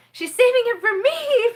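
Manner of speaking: a light high voice for Betty; quoting Betty. Retold or imitated. Betty teasing the vlogger, in a light high voice. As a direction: tease it as Betty would. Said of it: "Betty says".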